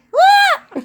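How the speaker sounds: sudden very high, loud whoop of fright; scared yelp. Real, not acted. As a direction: shriek as the bite nearly lands. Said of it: "I got scared".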